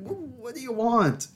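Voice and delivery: very high, drawn-out mock wail; slow, squealed comic protest; exasperated plea. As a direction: plead way up high, hammed-up and helpless. Retold question to staring ladies.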